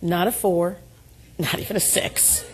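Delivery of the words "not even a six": usual speaking voice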